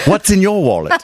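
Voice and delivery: upper-class British accent slogan; posh British accent, airy. Shown as a demonstration. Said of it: "I'd go for quite a posh British accent".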